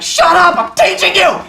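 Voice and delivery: high, hammed-up shout; yelling. Teacher imagining yelling while teaching, mock shout. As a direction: shout down the class in play. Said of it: "just yell at something".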